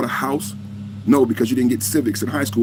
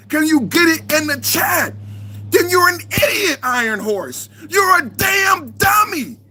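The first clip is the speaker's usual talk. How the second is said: furious shout; slower than usual. Real, not acted. Streamer yelling at troll Iron Horse in chat, furious shout. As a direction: scream the insult down at the troll.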